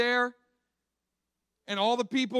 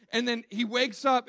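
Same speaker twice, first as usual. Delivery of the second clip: usual speaking voice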